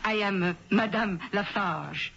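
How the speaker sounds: put-on a Midwestern lady faking a French accent; thick put-on French accent, a bit overdone; phony French accent. Perfume rep posing as French, phony French accent. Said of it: "It was a phony French accent".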